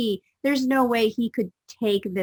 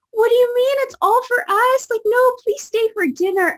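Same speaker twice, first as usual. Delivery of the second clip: put-on much higher, louder child voice, urgent; pleading little boys; 8 dB louder than usual. Boys can't believe the food is theirs, high pleading child voice. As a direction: plead like a stunned young boy.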